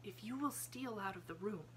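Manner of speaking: soft half-whisper; Sarah asks Miss Amelia in a hush. A narrator's reading. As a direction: keep it hushed and calm. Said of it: "half-whisper".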